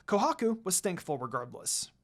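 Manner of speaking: usual speaking voice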